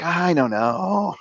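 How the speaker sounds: slow, drawn-out mock innocence; feigned ignorance. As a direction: stretch it out evasively. Acting evasive answer to mom.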